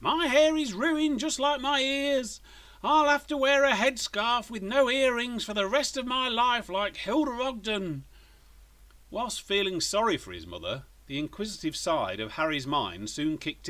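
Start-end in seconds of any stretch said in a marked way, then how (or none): 0.0-8.0 high, loud panicked wailing; frantic. A narrator's reading. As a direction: fret in a frantic rush. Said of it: "Missus Scramble's frantic voice".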